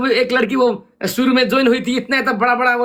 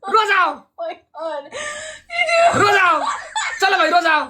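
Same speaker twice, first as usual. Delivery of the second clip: high laughing taunt, tossing it at her over and over; cheeky singsong jeering; gleeful taunt; 6 semitones higher than usual. Real, not acted. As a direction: tease her to go ahead and cry, chuckling. Teasing her to go cry.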